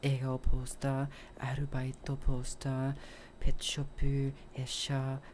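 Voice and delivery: soft, low recited checklist, imitated; hushed monotone inventory; counting under breath. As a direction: murmur through the list item by item. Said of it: "the whole time he goes through".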